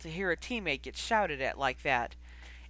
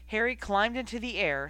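ordinary delivery

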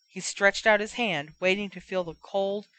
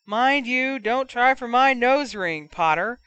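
higher, louder mocking taunt; jeering fan; 5 semitones higher, 6 dB louder than usual. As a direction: taunt him from the stands.

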